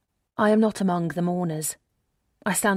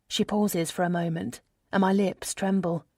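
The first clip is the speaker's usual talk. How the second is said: plain reading voice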